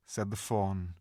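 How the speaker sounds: ordinary delivery, nothing marked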